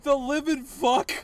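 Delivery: loud, slow shocked outburst; shocked exclamation. Real, not acted. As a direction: blurt it out in disbelief. Shocked by the game.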